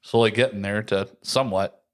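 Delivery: ordinary talk, nothing marked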